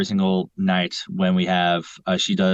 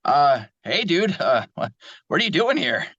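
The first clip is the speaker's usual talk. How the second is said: cocky, higher speedy hero voice; brash young hedgehog, full of himself; Sonic the Hedgehog; 6 semitones higher than usual. Acted. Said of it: "maybe you can do a Sonic".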